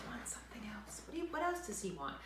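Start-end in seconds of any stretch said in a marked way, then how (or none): none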